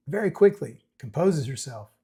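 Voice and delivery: ordinary talk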